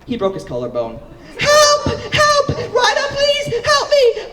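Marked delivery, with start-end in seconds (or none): 1.4-4.3 very high, loud hammed-up screams for help; mock cry for help. Cincy with broken collarbone calls Ryder, high loud mock screams. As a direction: shriek it like a panicked victim. Injured friend calling out.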